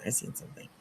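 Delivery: whispered knowingly; a knowing whisper to viewers; whispered aside. Shown as a demonstration. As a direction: breathe it out like a secret.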